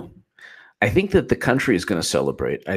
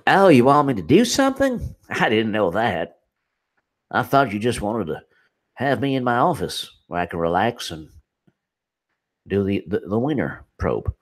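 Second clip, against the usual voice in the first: Jeff Sessions impression; 4 semitones higher than usual. Host mocking the attorney general in a Southern accent. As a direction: play a sleepy Southern senator with a Southern accent, faking innocence. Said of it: "Sessions is just like".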